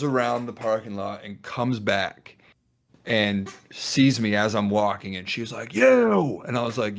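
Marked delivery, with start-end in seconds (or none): 5.7-6.4 a loud, high one-word accusation; accusing shout. Retold or imitated. Angry older driver spotting the busboy, loud high shout. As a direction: point and yell at him. Angry woman spots him walking.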